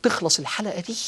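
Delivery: ordinary talk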